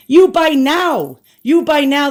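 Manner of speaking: mock Chinese seller. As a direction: insist like a pushy overseas seller. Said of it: "they were fighting with me".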